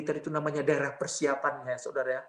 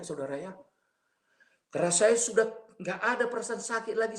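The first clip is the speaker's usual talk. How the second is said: ordinary talk, nothing marked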